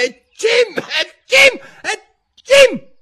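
loud, high-pitched mock sneezes; acted sneezing. A narrator's reading. People by the courtroom door suddenly start sneezing, mock sneezes. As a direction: burst out in repeated exaggerated sneezes. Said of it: "a starnutire".

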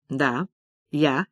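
low, soft-spoken courtesy; polite. Acted. Businessman answers the women, politely. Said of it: "вежливо откликнулся Василий Георгиевич".

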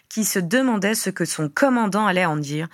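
usual speaking voice

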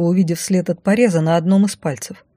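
ordinary delivery, nothing marked

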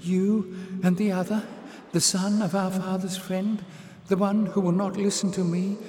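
put-on high, eager young voice; excited girl. Little sister excitedly telling Jasmine of the victory. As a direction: rush it out excitedly. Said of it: "the voice was excited now".

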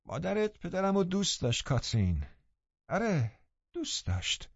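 high, soft gloating taunt; triumphant taunting. A narrator's reading. Linton taunting Catherine about her mother, in triumph. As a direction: rub it in, high-pitched and soft.

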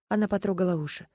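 ordinary delivery, nothing marked

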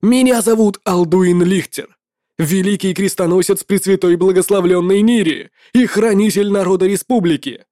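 proud knight declaring his name; noble paladin. Acted. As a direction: announce yourself like a holy crusader. Paladin introduces himself.

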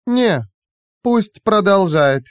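high, slow and dismissive; curt refusal. A narrator's reading. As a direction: overrule them coolly in a high voice. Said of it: "отрезал И И".

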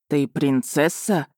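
slow, hushed mild horror; a dawning understated dread; a touch of horror, slow; horrified. A narrator's reading. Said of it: "неподдельный ужас".

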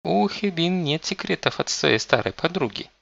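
put-on high lecturing girl's tone; slightly preachy. A narrator's reading. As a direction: lecture him a little, high. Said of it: "несколько поучающим тоном".

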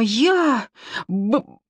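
stuck on the first sound, then a slow wail; stammer, then blurt it out slowly; acted tearful stutter, starting high. A narrator's reading. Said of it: "заикалась Сима".

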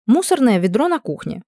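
ordinary delivery

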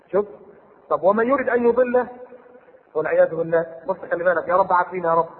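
ordinary talk

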